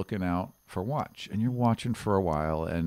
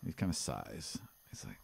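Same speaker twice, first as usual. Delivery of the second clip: whisper; 5 semitones lower, 13 dB quieter than usual. A narrator's reading. GM describes sighing guard.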